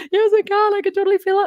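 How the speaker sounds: high, loud, overwhelmed yes through tears; tearful yes. Retold or imitated. Breathless high cry of yes. Client crying, feeling grandmother's hug.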